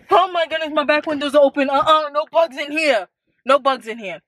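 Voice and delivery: alarmed. Real, not acted. Back window open, bugs.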